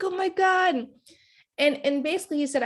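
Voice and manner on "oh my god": higher, bright oh my God; excited exclaim. Retold or imitated. Reliving the love confession, an excited higher exclaim. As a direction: let it burst out, thrilled. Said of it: "I was like".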